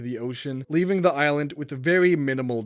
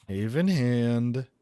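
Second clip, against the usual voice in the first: sinister villain greeting; 4 semitones lower, much slower than usual. Acted. Villain greeting before attack.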